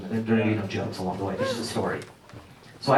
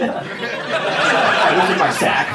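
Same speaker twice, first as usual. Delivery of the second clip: usual speaking voice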